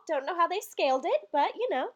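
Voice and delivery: normal talking voice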